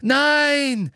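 very high, loud scream of no. A narrator's reading. Boy screams to stop the headmaster, loud and high. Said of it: "schrie Harry".

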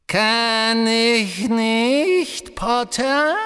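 high, loud, very drawn-out menace; high, cold voice. A narrator's reading. Dark lord suddenly answers Potter, high cold voice. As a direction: stretch the question out coldly, high and loud. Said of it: "sagte eine hohe, kalte Stimme".

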